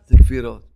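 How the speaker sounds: a stern low זה כפירות; low punched refrain. Acted. Preacher rebuking doubts, low stern verdict. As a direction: drop low, stress זה and say it hard.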